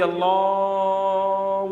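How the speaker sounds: imitating an overlong drawn-out Allah; hammed-up long azan call; mocking stretched azan. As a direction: stretch the word out absurdly, mocking. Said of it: "اتنا کھینچتا ہے اذان کو".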